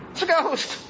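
high mock fright; frightened cry. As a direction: gasp it out like a spooked disciple.

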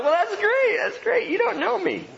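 high sing-song brush-off to a stranger; mock cheerful reply. Pastor mimicking himself greeting overeager visitors, high and mock cheerful. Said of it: "And I'm like".

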